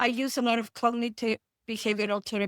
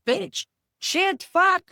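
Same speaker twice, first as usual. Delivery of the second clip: high rising string of curses, last one loudest; cussing burst; 7 semitones higher, 4 dB louder, much slower than usual. Retold or imitated. Therapist showing how she swears with clients, building to a loud burst. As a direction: let the swears pile up and pop. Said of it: "I'm gonna be like".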